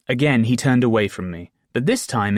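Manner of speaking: ordinary delivery, nothing marked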